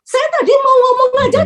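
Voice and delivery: high-pitched indignant complaint; retold anger. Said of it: "Saya terus terang marah". (protes kesal bernada tinggi)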